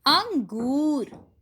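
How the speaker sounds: अंगूर stretched very slowly, syllable by syllable; slow syllable reading. Shown as a demonstration. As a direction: draw out अंगूर for the class. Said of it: "हम कुछ शब्दों को पढ़ते हैं". (अंगूर को धीरे धीरे खींचकर पढ़िए)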